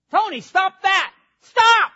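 high shrill-pitched shout at Tony; mock scolding yell. Preacher hamming a nagging shout at Tony. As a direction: bark the order, hammed up.

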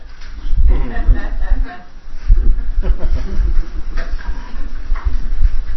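a long breathy burst of laughter; laughing. Real, not acted. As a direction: laugh loudly at your own joke. Laughs at own accent joke.

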